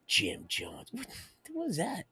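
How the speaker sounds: hushed, secretive whisper; breathy whispered aside. Real, not acted. Aside, unvoiced.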